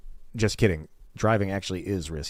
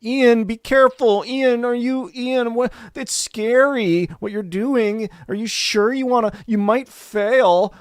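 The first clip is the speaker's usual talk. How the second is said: high, loud, slow mock-fretful whine; worried nagging people; 9 dB louder, slower than usual. People warning Ian off sun gazing, in a loud high mocking whine. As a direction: mimic the worriers who nag him, whining. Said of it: "people are like".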